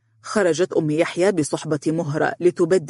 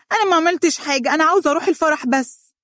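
put-on much higher, fast childish protest; girl screaming; 12 semitones higher, much faster than usual. A narrator's reading. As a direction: yelp it from behind his back. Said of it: "صرخت مهرة من خلف فارس". (صراخ طفلة محتجة)

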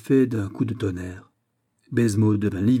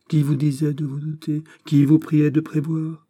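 higher, scornful rhetorical questions; disdainful; 6 semitones higher, 3 dB louder than usual. A narrator's reading. Bishop answers the governor's excuses, disdainful. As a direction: dismiss his excuses with contempt. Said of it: "répondit dédaigneusement Aramis".

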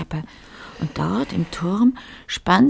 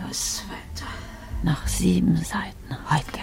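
inner whisper; 5 dB quieter than usual. Acted. Lotte urging herself on inwardly.

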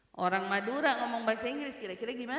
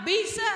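"Bisa" thrown back high and loud; emphatic, raised "Bisa"; confident answer; 8 semitones higher, 5 dB louder than usual. Acted. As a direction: answer "Bisa" without any doubt. Acted confident answer to challenge.

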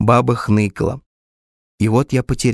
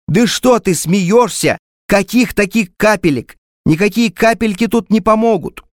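exasperated doctor; 7 semitones higher than usual. A narrator's reading.

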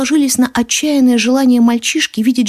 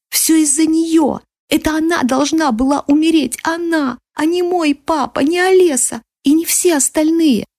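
put-on high, anguished child's voice; yelling boy; 6 semitones higher than usual. A narrator's reading. Boy yells that the wrong people died. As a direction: wail the blame high, without real volume. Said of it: "орал мальчишка, срывая голос".

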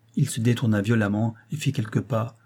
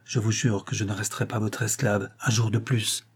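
ordinary delivery

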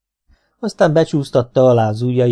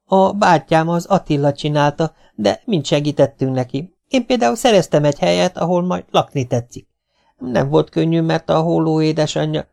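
put-on high-pitched kid's prattle; lightly voiced chatterbox; chattering child; 6 semitones higher than usual. A narrator's reading. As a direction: jabber on up high, explaining eagerly. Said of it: "karattyol Domi megint".

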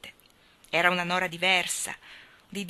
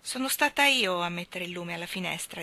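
usual speaking voice

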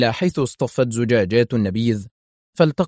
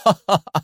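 higher, mocking ha-ha-ha; mocking laugh; 9 semitones higher, 4 dB louder than usual. A narrator's reading. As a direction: laugh derisively in separate bursts. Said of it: "أطلق ضحكة ساخرة". (ضحكة ساخرة)

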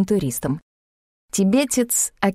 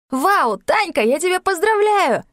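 much higher, bursting with joy; jubilant; 9 semitones higher than usual. A narrator's reading. Slava congratulates Tanya on being chosen, jubilant. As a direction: cheer her on. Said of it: "возликовал Слава".

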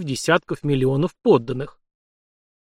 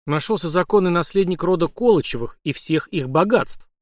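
ordinary delivery, nothing marked

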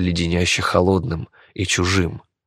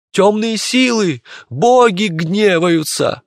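very high, drawn-out cry of alarm; high, stretched wail of doom; panicked crowd cry; 12 semitones higher, 3 dB louder, slower than usual. A narrator's reading. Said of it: "крикнул кто-то из толпы".

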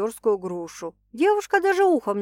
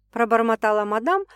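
ordinary delivery, nothing marked

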